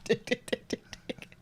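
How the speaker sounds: whispered scissor sound. Retold or imitated. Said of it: "That was the scissors".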